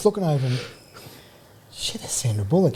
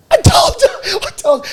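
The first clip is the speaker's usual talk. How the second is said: high, loud gloating I told you; triumphant shout; 7 dB louder than usual. Retold or imitated. To his mate after Sandra nodded, a high triumphant yell. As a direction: crow it out in triumph.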